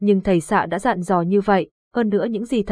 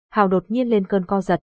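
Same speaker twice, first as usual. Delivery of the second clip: plain reading voice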